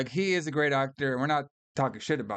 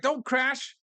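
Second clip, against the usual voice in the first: high-pitched, indignant mock outburst; mock pissed off; 11 semitones higher than usual. Host imitates Walken's character mad at the computer, mock pissed off. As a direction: whine it out, much higher, imitating his anger. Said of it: "he gets all pissed out about it".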